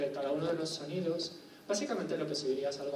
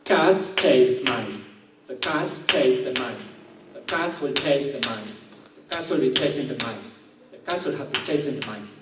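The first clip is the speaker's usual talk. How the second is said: louder, slow English lines with each stressed syllable hammered on the beat; stress-timed rhythm demo; 2 semitones lower, 10 dB louder, slower than usual. Shown as a demonstration. Teacher shows students English stress timing, loud and slow, beating each stress. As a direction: beat out the stresses in Cats, chase, mice as if clapping. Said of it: "prestéis atención a lo que va a suceder aquí".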